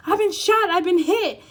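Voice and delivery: high, loud overacted panic, a touch calmer; a half-laughing repeat of the melodrama wound; mock dramatic victim. Mocking the client's reaction, a high, loud hammed-up cry. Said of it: "it's just a goddamn apology".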